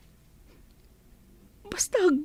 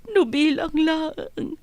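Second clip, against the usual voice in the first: ordinary talk, nothing marked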